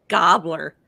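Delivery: "gobbler" with an open GOB vowel; mispronounced name. Shown as a demonstration. Southerner mangling a contestant's name, "gobbler" with GOB first. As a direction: say "gobbler" slowly, like a turkey's gobble. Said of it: "I can't call him Gabor".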